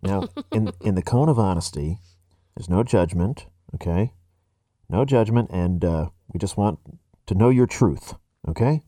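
low, hushed voice from inside a cone. Pretend. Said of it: "stop doing the cone voice".

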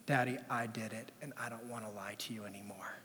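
owning up in a tiny voice; small confession. Retold or imitated. Daughter confesses before siblings.

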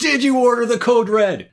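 loud courtroom demand; shouting. Acted. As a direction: bark it like a prosecutor. Movie quote shouted, A Few Good Men.

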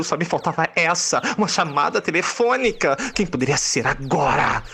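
usual speaking voice

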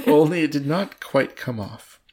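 words bubbling with laughter; laughing. Real, not acted. Reader laughing at the story while reading aloud. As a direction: chuckle through the line.

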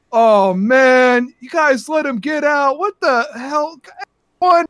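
high, loud complaint to the group; exasperated barkeep. Acted. Barkeep scolds the group for the escape, exasperated. Game master plays exasperated Stewart.

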